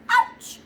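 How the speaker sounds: very high, loud squeal of pain; a sudden comic yelp; cat stung by gnats squeals. Acted.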